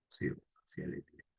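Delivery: breathy under-the-breath echo of the answer; murmured self-check; whispered repeat. Shown as a demonstration. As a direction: whisper "Circunstancial de tiempo" to yourself.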